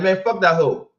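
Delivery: high, loud mock dismissal; dismissive scoff. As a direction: brush her off with a scoff. Dismissing a less attractive girl.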